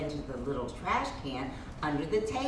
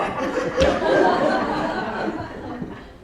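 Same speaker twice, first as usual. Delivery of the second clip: long, high, loud laugh; breathy laughter; 9 dB louder than usual. Real, not acted. Laughing at herself after the busy day, loud and high.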